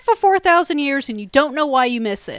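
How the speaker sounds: high, loud disbelief; incredulous retort. Acted. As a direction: throw it back in exasperated disbelief. Incredulous retort to whiny quote.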